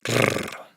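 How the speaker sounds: "Rrr" sin voz, como una erre vibrante sostenida; erre fuerte. Shown as a demonstration. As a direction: haz vibrar la lengua en "Rrr". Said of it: "se pronuncia fuerte". (erre múltiple alargada)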